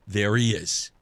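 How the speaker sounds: low, measured announcement; suspenseful, deliberate naming; dramatic reveal. Real, not acted. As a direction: drop the voice low for the big reveal. Dramatic reveal of the big guest.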